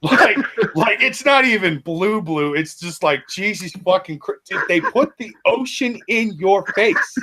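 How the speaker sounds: high, gushing amazement; amazed gushing. Real, not acted. Viewer gushing over a man's blue eyes, amazed. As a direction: marvel out loud, pitch way up.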